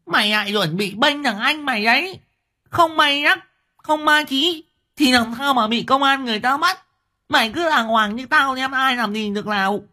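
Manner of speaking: very high, sharp scolding woman's voice; wife scolding narrator for defending husband; scolding mother. A narrator's reading. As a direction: snap back at the brother-in-law. Liên rounds on narrator.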